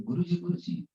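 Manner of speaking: high-pitched eager greeting to the teacher; eager calling out. Retold or imitated. Disciple running out to greet his guru, calling eagerly. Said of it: "he came out running".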